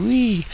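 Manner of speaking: high, drawn-out gleeful squeal; playful whee. Pretend. Trader riding the Sydney session move, playful high whee. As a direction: let out a soaring mock "whee".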